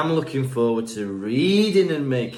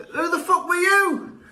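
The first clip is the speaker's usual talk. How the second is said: high, drawn-out comic yell; mock outraged shout; 3 dB louder than usual. Patient discovering the fake doctor, mock outraged shout. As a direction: yell it in put-on disbelief, pitched way up.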